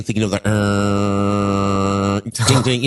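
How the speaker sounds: drawn-out screeching dial-up fax tones; modem noise. Retold or imitated. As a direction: sing out a fax machine connecting. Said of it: "That was a very good impression of a fax machine".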